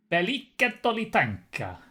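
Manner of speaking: put-on hammy Italian accent on a name; fake Italian accent. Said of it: "I said it in some sort of fake Italian".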